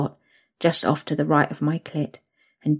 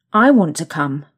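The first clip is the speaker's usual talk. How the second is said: louder, insistent demand; 7 dB louder than usual. Acted. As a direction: state it like a demand. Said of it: "I demand".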